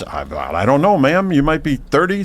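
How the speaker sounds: normal talking voice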